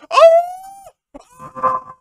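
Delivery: high-pitched shriek of surprise; shocked scream. Real, not acted. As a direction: scream in real disbelief. Reacts to a great pull.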